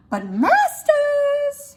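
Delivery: masters leaps high and slow; But masters, jumping way up high on masters. Shown as a demonstration. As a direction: pitch masters high and stretch it. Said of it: "maybe I'll go up and pitch there".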